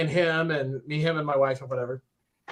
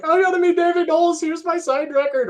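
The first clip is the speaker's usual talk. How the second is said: very high, loud mock-gushing squeal; freaked out; 14 semitones higher, 6 dB louder than usual. Fan bragging next day about his signed record, freaked out. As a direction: squeal it like a giddy fan showing off. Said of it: "Next day, I freaked out".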